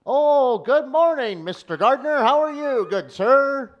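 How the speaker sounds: high, loud, overly polite voice. Retold or imitated. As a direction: chirp a prim greeting like a butler.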